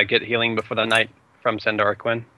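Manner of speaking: ordinary talk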